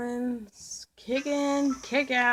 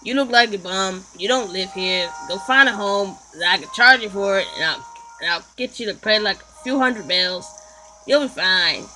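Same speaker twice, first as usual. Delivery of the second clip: low, loud landlord voice; 4 semitones lower, 6 dB louder than usual. Acted. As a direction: lower and louder, bossing a tenant. Player voices landlord character.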